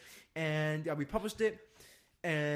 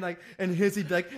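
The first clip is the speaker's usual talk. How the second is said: normal talking voice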